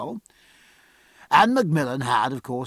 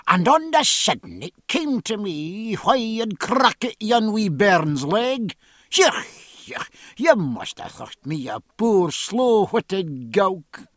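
put-on slow, higher broad Scottish accent; 6 semitones higher, slower than usual. A narrator's reading. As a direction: Scots burr with rolled R's. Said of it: "invaded by his native Scotch".

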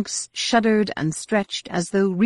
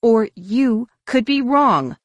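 slow, loud, higher-pitched retort; angry; 7 semitones higher, 6 dB louder, slower than usual. A narrator's reading. Mara answering an accusation of misleading, angry. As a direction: push back hard on each word. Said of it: "Mara said angrily".

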